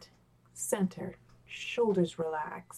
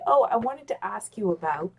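ordinary delivery

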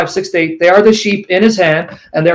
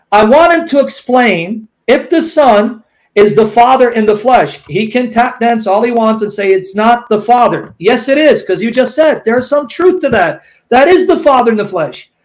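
usual speaking voice

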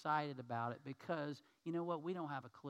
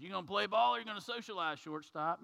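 higher, faster, barking umpire voice; gruff umpire; 5 semitones higher, 7 dB louder than usual. Acted. Umpire breaking up the shortstop's gesture, brisk and gruff. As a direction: hurry them along like an impatient ump.

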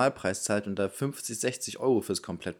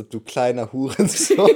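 slow, louder quoted insult with a laugh; heartfelt insult; 4 semitones higher, 9 dB louder than usual. Retold or imitated. The co-host repeats her heartfelt insult, louder and laughing. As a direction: echo her insult with relish. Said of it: "aus vollem Herzen gesagt".

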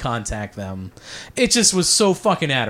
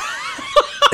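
a sudden squeal of a laugh, much higher; high burst of laughter. Real, not acted. Laughing at his friend never playing the Palladium, high burst of laughter. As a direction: let a real laugh break out.

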